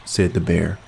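expressive read-aloud narration; storyteller voice with character lines; storybook reading. A narrator's reading. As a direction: read the fairy tale to an audience. Reads fairy tale aloud as sample.

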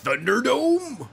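high, drawn-out swooping call; mock-announcer echo. Co-host supplies the arena's name, playful mock-announcer echo. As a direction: stretch it out in a playful put-on voice.

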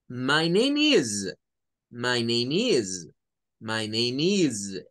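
slow "My name is", each word separate, "is" with z; model pronunciation. Shown as a demonstration. Teacher drills the phrase slowly for the class. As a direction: repeat slowly, clean English. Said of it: "Esa debe ser la pronunciación".

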